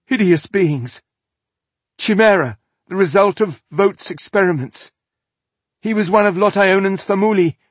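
high, loud, fearful rush; terrified captive describing monsters; breathless with fear. A narrator's reading. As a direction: gasp it out scared. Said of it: "breathless with fear".

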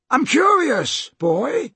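high-pitched taunting call. Acted. Slank questions Peter out on the rock, high call. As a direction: project the question across the water. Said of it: "He called out to Peter".

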